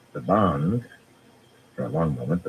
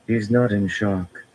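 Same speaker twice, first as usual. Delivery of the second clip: sad; 5 semitones higher, slower than usual. A narrator's reading. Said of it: "Min said sadly".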